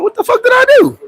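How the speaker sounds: can't believe there's a warrant; baffled outrage. Retold or imitated.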